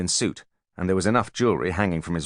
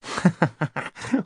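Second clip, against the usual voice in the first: high dry chittering laugh; thin high cackle; crickety laugh; 12 semitones higher than usual. Acted. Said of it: "It sounded like a box of crickets".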